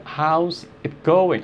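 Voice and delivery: slow, with HOW'S set apart; slowed contraction. Shown as a demonstration. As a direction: draw out "How's it going", word by word. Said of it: "How's, assim, ó". (contração dita devagar)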